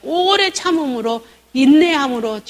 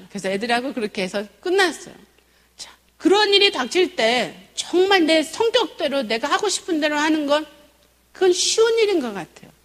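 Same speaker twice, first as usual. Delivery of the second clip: usual speaking voice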